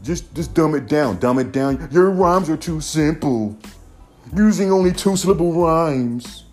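mocking fan voice. Vlogger imitates fans telling rappers to dumb it down, high whiny mockery.

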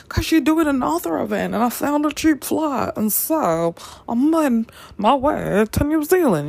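high, giddy explaining the trip; excited explanation. Retold or imitated. Answering why she flew to New Zealand, giddy and high. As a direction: justify it giddily.

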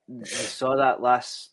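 ordinary talk, nothing marked

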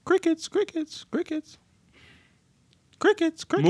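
high little chirping voice; high-pitched repeated chirps; mock crickets. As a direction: chirp it like crickets in an awkward silence. Mimics awkward silence with cricket calls.

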